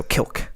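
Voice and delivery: "Kilk" with no vowel between l and k; "Kilk", like silk with a K; one-syllable pronunciation. Shown as a demonstration. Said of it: "Without the second I".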